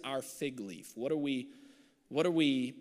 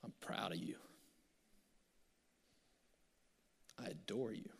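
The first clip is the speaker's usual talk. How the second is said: breathy, voiceless whisper of love; a preacher whispering a father's loving words; tender whisper; 3 semitones lower, 11 dB quieter than usual. Shown as a demonstration. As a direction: whisper it like a secret blessing.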